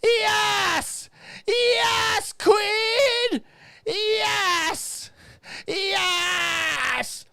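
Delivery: high, drawn-out shouts of adulation; mock cheering. Reading gushing comments to listeners, hammed-up cheering. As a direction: stretch it into a long, ringing, over-the-top yell. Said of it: "everyone's saying".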